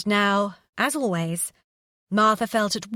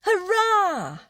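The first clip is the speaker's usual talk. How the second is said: put-on much higher, bright child's hurrah; excited cheer; 12 semitones higher than usual. Child cheering at good news.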